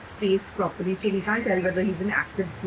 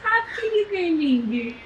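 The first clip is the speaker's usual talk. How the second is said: 1 laughing tearfully; 11 semitones higher, 3 dB louder, much faster than usual. Real, not acted. Emotional words to baby.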